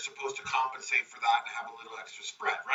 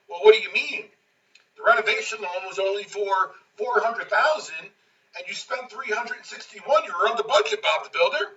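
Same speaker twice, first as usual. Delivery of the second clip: louder, higher, pressing; exasperated grilling; 6 semitones higher, 6 dB louder than usual. Retold or imitated. Pete confronting the builder over the budget, louder exasperated. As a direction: demand he explain himself.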